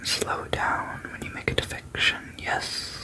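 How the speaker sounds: usual speaking voice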